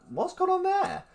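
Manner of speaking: high-pitched mock bewilderment; incredulous, squeaky question; baffled. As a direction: react as if thrown by the sudden change. Acted reaction to changed phone voice.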